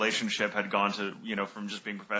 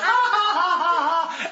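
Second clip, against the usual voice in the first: high loud playful shriek, laughing; mock scream back; 5 dB louder than usual. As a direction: scream back at her for fun. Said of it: "And she just goes".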